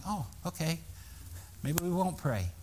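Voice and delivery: deflated. Retold or imitated. Pastor deflated by hang on.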